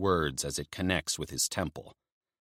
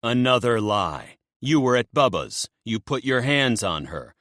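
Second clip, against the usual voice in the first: angry accusation; 5 semitones higher, 6 dB louder than usual. Acted. Narrator accusing, kneeing him.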